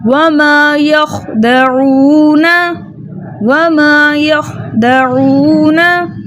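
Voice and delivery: वमा यख्दऊना slowly recited, long aa and oo, kh stopped; Tajweed recitation. Shown as a demonstration. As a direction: stretch the long vowels in वमा यख्दऊना, halt on kh. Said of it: "हमने कैसे पढ़ा".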